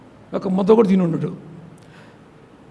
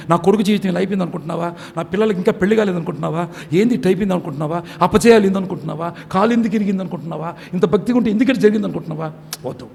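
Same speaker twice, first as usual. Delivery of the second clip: ordinary talk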